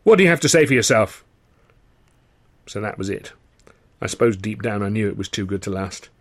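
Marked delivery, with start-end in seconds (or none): 0.0-1.2 raised, indignant company boss; higher, louder dressing-down; scolding manager. Acted. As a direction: lay into him like a stern boss. Sid scolding the narrator.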